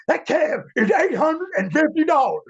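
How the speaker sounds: high, indignant boss voice; Jim Ross. Retold or imitated. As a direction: squeak out the price, outraged. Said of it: "he comes back and he says".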